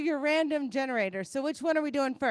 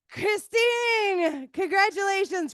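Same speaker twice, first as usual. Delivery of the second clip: higher, louder winner call; excited call; 5 semitones higher, 5 dB louder, slower than usual. Real, not acted. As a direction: cheer the winner's name.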